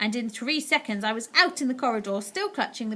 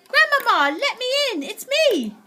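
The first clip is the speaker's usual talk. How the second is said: much higher, louder shout; 14 semitones higher, 8 dB louder than usual. A narrator's reading. Mouse boy shouting for grandmother at the door. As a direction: call out as loud as you can.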